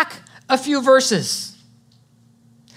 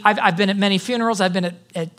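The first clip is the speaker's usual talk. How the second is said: ordinary talk, nothing marked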